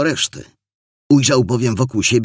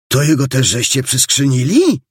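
higher-pitched amazed question; astonished; 5 semitones higher, 4 dB louder than usual. A narrator's reading. Boy asks about the captured suspect, astonished. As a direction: ask it in astonishment. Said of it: "zapytał zdumiony".

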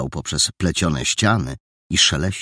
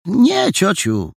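eager denial; 13 semitones higher, 5 dB louder, slower than usual. Acted. Boy defends friend.

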